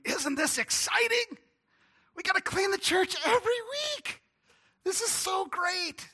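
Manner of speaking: high, thrilled squeal of excitement; excited Tom. Retold or imitated. Young Tom gushing to the pastor, high and thrilled. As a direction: gush like an eager young helper.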